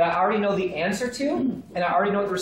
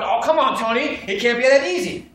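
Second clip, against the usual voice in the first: lightly acted protest, a bit louder; mildly mocked skepticism; 2 semitones higher, 5 dB louder than usual. Trainees doubting Tony's simple method, mock protest. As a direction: push back like a doubting trainee. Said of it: "some of you going".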